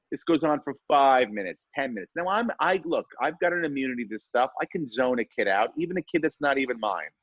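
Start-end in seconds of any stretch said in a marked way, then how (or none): none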